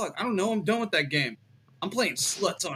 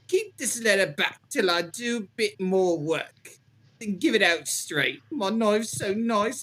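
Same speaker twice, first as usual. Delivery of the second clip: reading a killer's letter in a hammed-up British accent; jolly British accent. Reading the Ripper letter to friends, in a hammed-up British accent. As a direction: lay on a jolly British accent, pausing as you go. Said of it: "when you were doing the accent".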